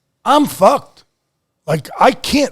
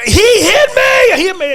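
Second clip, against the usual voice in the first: desperate yell; 12 semitones higher, 4 dB louder than usual. Retold or imitated. Wrestler hauled off the mat, yelling in protest. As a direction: yell it while being hauled off.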